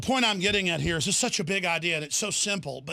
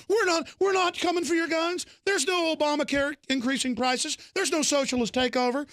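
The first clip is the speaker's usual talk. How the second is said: mocking the liars; 7 semitones higher than usual. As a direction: ham up the fake reassurance. Mocking officials' denials.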